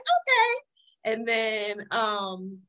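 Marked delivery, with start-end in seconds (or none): 0.0-0.6 high squeaky okay. Retold or imitated. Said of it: "And I'm like".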